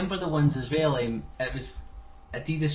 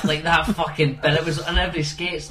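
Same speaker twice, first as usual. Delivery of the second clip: usual speaking voice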